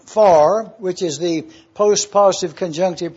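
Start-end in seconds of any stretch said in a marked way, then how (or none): 0.1-0.7 "for" punched louder as its own word; emphasis on for. Shown as a demonstration. Preacher stressing "for" to his congregation. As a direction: lift out "for" with a firm hit. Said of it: "We have an emphasis here on the expression".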